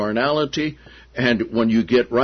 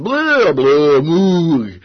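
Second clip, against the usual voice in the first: much higher, silly lowing of cattle; mock animal moo; 4 semitones higher, 6 dB louder, much slower than usual. Preacher imitating the herd's noise in the desert, playful mock moo. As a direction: moo like a thirsty cow, half joking.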